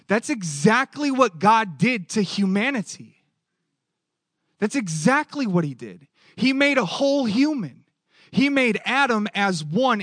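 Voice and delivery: preaching at full force. Acted. Pastor pressing the point to his congregation, full preaching force. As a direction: build it up like a pulpit climax.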